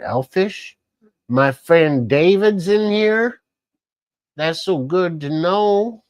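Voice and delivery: slow, high-pitched mock Southern drawl; loud, stretched, badly faked Southern accent; weird Southern accent. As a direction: greet them in a drawn-out, rising pretend Southern accent. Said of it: "that kind of weird Southern accent".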